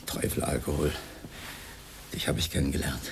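low, soft, ominous aside; a low, grim murmur to oneself; brooding refrain. Acted. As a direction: drop the voice low and mutter it darkly.

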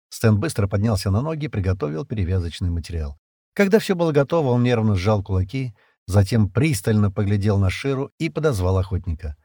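ordinary delivery, nothing marked

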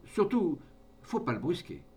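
put-on old man calls out, high and loud on Surtout; mild call, then back to normal. A narrator's reading. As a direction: shout the warning to the others. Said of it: "leur cria".

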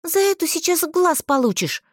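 high-pitched indignant retort. A narrator's reading. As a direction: fire back, voice jumping up. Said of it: "возмутилась я".

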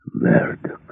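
low, husky menace; hoarse voice. A narrator's reading. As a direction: rasp the name out low. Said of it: "а потом хриплый голос, голос Рэмбо". (хрипло и низко, с угрозой)

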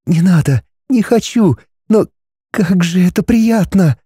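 put-on young woman voice. A narrator's reading. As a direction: play Linda, torn and reluctant. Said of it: "Линда нехотя застонала".

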